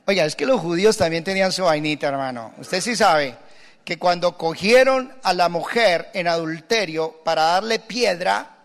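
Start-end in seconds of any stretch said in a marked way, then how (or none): none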